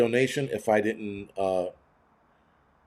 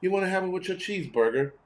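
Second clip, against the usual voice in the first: higher, lightly voiced snooty waiter; a hint of an upscale waiter offering water; mock posh waiter; 6 semitones higher, 3 dB quieter than usual. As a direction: offer it like a fussy server, a touch mocking. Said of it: "Imagine someone going".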